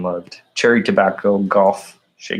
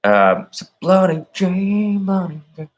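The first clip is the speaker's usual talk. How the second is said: high, slow Southern drawl on the name; Southern accent; 9 semitones higher than usual. Retold or imitated. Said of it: "Sweet Tea's Southern accent".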